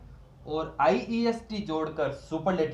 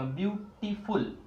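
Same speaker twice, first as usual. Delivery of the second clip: ordinary talk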